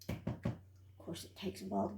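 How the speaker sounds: breathy under-the-breath aside while scraping the jar; a quick whispered "of course" to herself. Real, not acted. Cook scraping the jar mutters to herself in a whisper.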